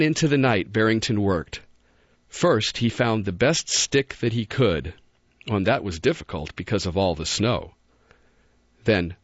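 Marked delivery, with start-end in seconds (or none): none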